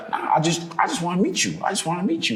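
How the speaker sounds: high, casual put-on director voice; Gerard McMurray, relaxed director. Director tells nervous actor it's just a meeting, relaxed imitation. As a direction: brush it off lightly, voice lifted high. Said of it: "he's so relaxed".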